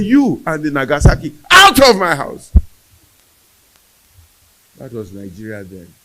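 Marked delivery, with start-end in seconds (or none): 0.0-2.5 high, full-volume retold shout; explosive yell, building to the last words; screaming mother. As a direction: act out a mother throwing someone out at the top of her voice. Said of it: "She kept on screaming and screaming".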